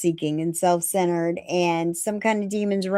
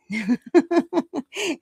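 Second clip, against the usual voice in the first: high, amused chuckling; real laugh; 6 semitones higher than usual. Real, not acted. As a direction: laugh at your own joke. Laughs at her own joke.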